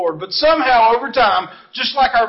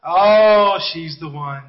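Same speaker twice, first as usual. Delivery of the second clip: mock lovestruck boy; 5 semitones lower, slower than usual. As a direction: imitate a smitten schoolboy, sinking into a low sigh. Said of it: "But every one of them".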